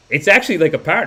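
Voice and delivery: normal talking voice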